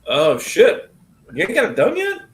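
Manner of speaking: high, loud disbelief; surprised. Real, not acted. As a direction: sound shocked it isn't done. Surprised friend teasing.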